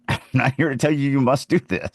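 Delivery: normal talking voice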